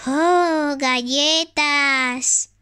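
ordinary talk

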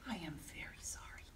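sighing, whispered apology; a hushed, guilty sorry. Acted. Monster apologizing to Mouse in a sighing whisper.

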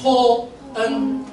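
토恩 drawn out slowly, syllable by syllable; slow syllable demo. Shown as a demonstration. Teacher sounding out 토恩 slowly for students. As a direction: stretch 토恩 slowly for the class.

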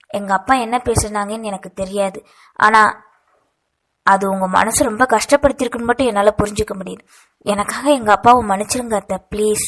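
plain reading voice

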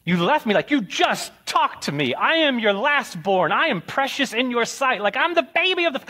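put-on a little boy pleading, climbing to a squeal; wailing child. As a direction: youngest son protesting to Dad. Retelling being left behind, wailing.

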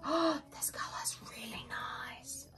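unvoiced, hushed awe; soft real whisper over a pretty color. Real, not acted. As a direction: whisper it like it's precious. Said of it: "I don't know why I'm whispering".

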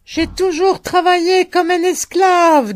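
high, loud bellow; old fellah bellows his grievance. A narrator's reading. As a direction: bawl it out. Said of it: "gueula le vieux Fellah".

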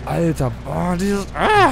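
high, loud fright; startled cry. Real, not acted. Scared by shadows, cries out.

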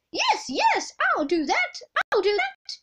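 very high, eager squeal; volunteer in an excited high voice; eager cry. Acted. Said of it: "cried Amelia".

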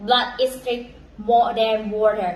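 repeated slowly, word by word; slow careful English repeat. Shown as a demonstration. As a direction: say it again slowly for learners. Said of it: "อีกทีนึงนะคะ".

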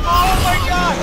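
excited shriek. Real, not acted. Watching the glitch play out.

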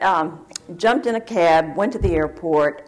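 normal talking voice

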